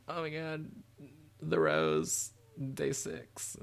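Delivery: mock fangirl gushing in a put-on voice; Cheryl. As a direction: imitate a rival fan swooning over other bands. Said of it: "Cheryl's gonna be coming here like".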